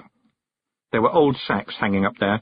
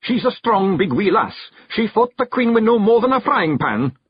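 higher Scots brogue, fierce pride; Rob, proud and fierce; 12 semitones higher, 4 dB louder than usual. A narrator's reading. Rob insists to Billy the lass is strong, fierce Scots voice. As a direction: defend the lass hotly.

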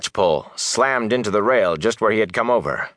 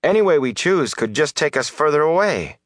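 higher-pitched, agitated protest; raised-pitch voice of protest; 6 semitones higher than usual. A narrator's reading. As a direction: object with rising exasperation.

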